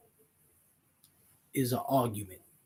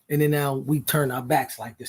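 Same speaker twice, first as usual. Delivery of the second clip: ordinary delivery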